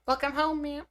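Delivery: very high strained femme voice; femme voice attempt. Shown as a demonstration. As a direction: push it way up for a femme voice. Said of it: "No, that's terrible".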